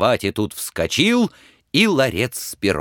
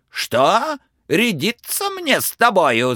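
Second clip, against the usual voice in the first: high, indignant yell; angry shout; 12 semitones higher than usual. Acted. Tsar shouts at the defiant Ivan, outraged. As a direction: bellow in outrage. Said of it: "закричал он".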